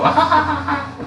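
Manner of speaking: put-on very high, bubbly baby laugh; imitating toddler giggle. Uncle mimics little kids laughing at peekaboo, high giggle. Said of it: "they go".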